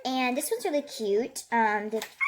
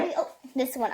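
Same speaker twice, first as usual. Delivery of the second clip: ordinary talk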